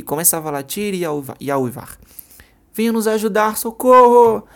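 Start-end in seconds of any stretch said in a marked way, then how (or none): 2.7-4.5 high and very loud screaming; desperate scream out the window; scream for help. Acted. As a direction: yell with all your breath.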